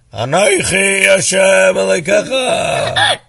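loud, high proclaiming chant; grand chanted announcement; booming divine voice. Acted. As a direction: proclaim it like a voice from heaven. Voice of God in story.

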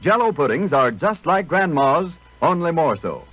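usual speaking voice

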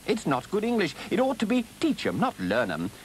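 high, fussy grammar scolding; pedantic correction. Acted. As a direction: correct him, pitching up on TEACH 'EM. Rat pedantically corrects Toad's grammar.

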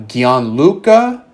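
tentative Spanish accent on "Gianluca", slow with a questioning rise. Shown as a demonstration. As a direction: try "Gianluca" in a Spanish accent, slowly and unsure. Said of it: "try to use my Spanish accent there".

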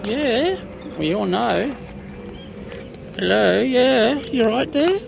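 loud high bird calling. Real, not acted.

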